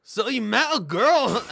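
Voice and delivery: slow, high attempt at Scottish accent. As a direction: try a Scottish accent and fail. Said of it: "I can't do Scottish".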